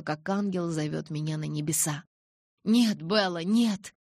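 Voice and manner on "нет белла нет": raised, louder plea; pleading. A narrator's reading. Angel begging Bella not to die, pleading. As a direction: beg with rising urgency. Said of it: "упрашивал ангел".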